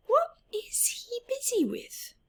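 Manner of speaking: high whispered aside; whispered question. Real, not acted. Teacher asks children secretively.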